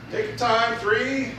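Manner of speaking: ordinary delivery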